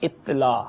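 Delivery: اطلاع in two syllables, it-laa; wrong pronunciation. Shown as a demonstration. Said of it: "یہ غلط ہے".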